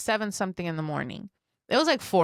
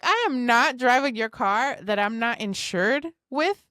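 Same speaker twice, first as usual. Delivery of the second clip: retold refusal, slow and emphatic; 4 semitones higher, 4 dB louder than usual. Woman refusing the neighbor's car, emphatic and indignant. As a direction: spell out a firm no, higher and slower.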